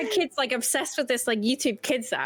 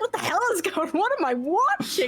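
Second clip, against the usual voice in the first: retold disbelief; 7 semitones higher than usual. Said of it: "And I was like".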